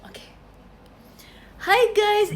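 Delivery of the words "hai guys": loud, high chirpy hello; bright greeting. Pretend. Greeting viewers as if nothing happened, loud chirpy hello. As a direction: greet them as if the stream just began. Restarting stream as if new.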